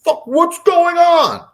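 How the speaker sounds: high, loud retold outburst; angry dad. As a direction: imitate an angry father hollering through the house. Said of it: "And he's angry".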